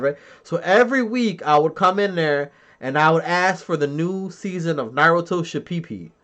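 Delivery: normal talking voice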